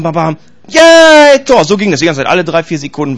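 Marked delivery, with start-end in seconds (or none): 0.0-1.6 high, loud drawn-out cheer; bam, then a long high yell; mock goal cheer. Preacher mimicking himself cheering at the goal DVD, mock goal cheer.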